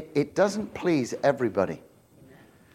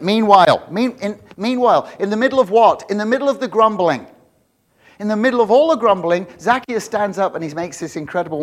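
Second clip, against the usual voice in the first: loud, raised-pitch pulpit delivery; emphatic preaching; 6 semitones higher, 9 dB louder than usual. Real, not acted. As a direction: hammer the verse home to the congregation. Preaches the verse at full force.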